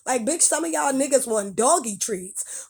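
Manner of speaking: ordinary talk, nothing marked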